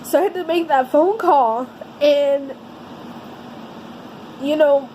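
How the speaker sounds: usual speaking voice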